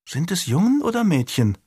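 put-on high, curious child's question; small girl asking innocently; little girl. A narrator's reading. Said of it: "fragte die Kleine".

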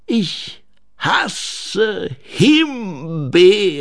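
langsam gedehnter Gnomen-Abscheu; angewidert. A narrator's reading. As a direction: koste den Hass Silbe für Silbe aus. (angewidert und gedehnt)

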